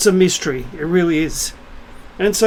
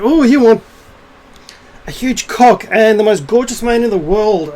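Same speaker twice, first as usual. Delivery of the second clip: higher, louder mock-gushing oohs; mocking demands; 4 semitones higher, 4 dB louder than usual. Mimicking what modern women want, mocking. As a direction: make fun of a greedy wish list.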